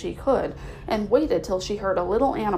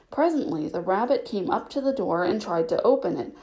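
ordinary delivery

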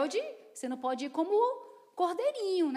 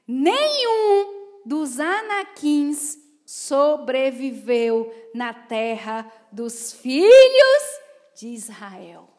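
slow, louder dramatic Bible reading; solemn scripture reading; 3 semitones lower, 10 dB louder, much slower than usual. Acted. Preacher reading Joshua to the congregation, slow and grand. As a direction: proclaim the verse slowly and loud.

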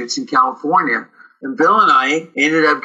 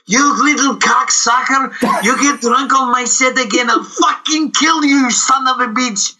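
high, strained furious threat; furious director; 11 semitones higher than usual. Retold or imitated. Director George Miliklovich yelling at Bill for drinking on set, in a high furious voice. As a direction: rage at the actor like a screaming boss. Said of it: "he goes".

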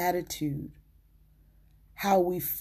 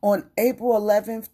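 proclaimed loudly; 3 semitones higher, 8 dB louder than usual. Acted. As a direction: call out the date like a proclamation. Date proclaimed in sermon climax.

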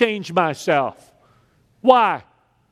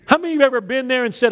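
usual speaking voice

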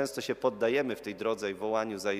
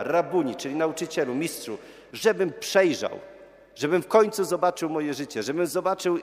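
high, loud, determined plea; decisive answer; 4 semitones higher, 4 dB louder than usual. Retold or imitated. Blind beggar tells Jesus he wants to see, decisive and loud. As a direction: answer with full conviction, as the beggar. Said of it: "I on zdecydowanie odpowiada".

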